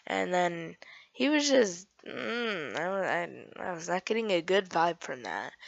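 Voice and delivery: ordinary talk, nothing marked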